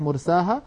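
مرساها with a clear open aa and a heavy ra; open alef. Shown as a demonstration. Teacher contrasting the open مرساها with the bent مجريها. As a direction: keep مرساها fully open, no ee. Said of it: "ويفتح".

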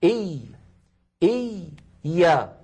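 "إي، يا" with the first ya held still; doubled ya split. Shown as a demonstration. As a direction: say "إي، يا" as two parts, lingering on the first. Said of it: "أتكلم الآن على الياء الأولى". («إي، يا» بياء ساكنة ثم متحركة)

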